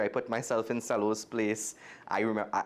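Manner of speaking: ordinary delivery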